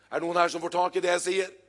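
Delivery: ordinary delivery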